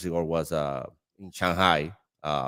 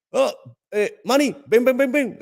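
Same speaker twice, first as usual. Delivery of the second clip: high, loud teasing call across the room; co-workers calling out; 6 dB louder than usual. Retold or imitated. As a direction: shout his name and beckon, mocking him. Chinese co-workers calling him over.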